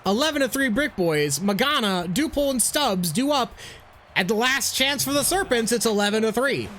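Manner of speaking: baseball announcer play-by-play. Acted. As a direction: call the game like a broadcaster. Plays announcer in game.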